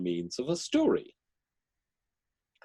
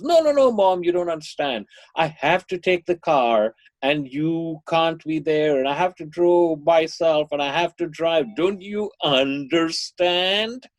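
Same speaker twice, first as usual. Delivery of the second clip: begging, wheedling son; 6 semitones higher, 4 dB louder than usual. Retold or imitated. Son begging his mother for the car keys, wheedling. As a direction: plead like an exasperated kid wheedling Mom. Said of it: "the child begs and pleads and wheedles".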